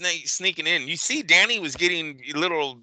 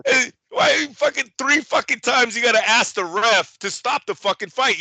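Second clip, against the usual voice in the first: higher, louder angry rant; outraged rant; 10 semitones higher, 4 dB louder than usual. Real, not acted. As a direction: vent at the corner. Ranting at the corner.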